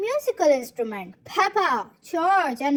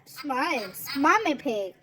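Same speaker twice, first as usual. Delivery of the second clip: ordinary delivery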